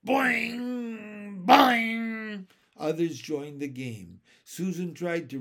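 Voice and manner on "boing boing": high, drawn-out springy calls; two ringing boings, the second louder; yelled boings. A narrator's reading. Children joining the curl-tweaking game, yelling.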